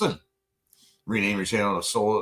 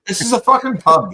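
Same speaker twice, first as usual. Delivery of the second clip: exasperated; 7 semitones higher, 9 dB louder than usual. Real, not acted. Exasperated outburst.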